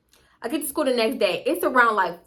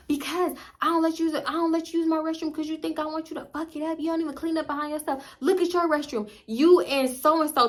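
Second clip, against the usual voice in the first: higher-pitched mock mom rant; scolding mom; 6 semitones higher than usual. Mom arguing with daughter about the restroom, scolding. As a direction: fire back as a fed-up mother.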